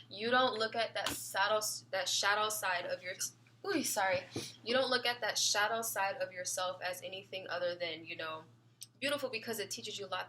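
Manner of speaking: ordinary talk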